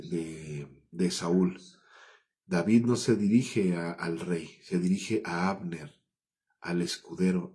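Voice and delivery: ordinary talk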